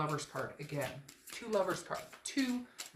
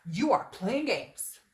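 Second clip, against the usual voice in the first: mock angry teacher; 3 semitones higher, 8 dB louder, slower than usual. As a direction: scold like a put-on angry teacher. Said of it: "an angry teacher".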